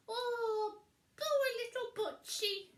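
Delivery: high, loud pitying witch voice; high sympathetic witch cry; Winnie voice. A narrator's reading. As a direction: coo with pity, high and loud. Winnie pities the dog.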